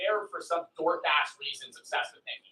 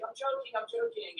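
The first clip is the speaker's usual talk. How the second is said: mock nervous backpedal; 9 semitones higher, 5 dB quieter than usual. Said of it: "She was like".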